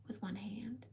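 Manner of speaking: whispered punchline. Real, not acted. Telling viewers about catching her sister, whispered punchline. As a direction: drop to a whisper for the joke.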